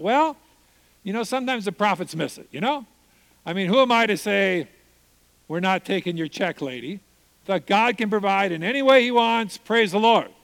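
an eager rush of justification; brightly convincing himself it's fine; eager self-talk. Retold or imitated. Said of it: "And I thought".

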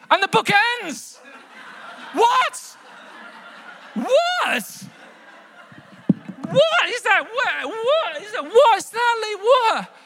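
very high, squealing incredulous outburst; outraged disbelief. Acted. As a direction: act utterly flabbergasted, pitch shooting right up. Acted disbelief at the book's ending.

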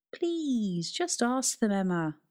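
earnest pleading plea; pleading father. Acted. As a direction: plead with her gently. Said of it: "pleaded Russell".

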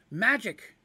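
loud, high sudden cry; cried out. Acted. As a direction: blurt it out to hook him. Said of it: "cried out Polly quickly".

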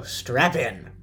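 ordinary talk

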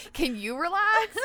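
high, slow, laughing; giggling mock therapist.

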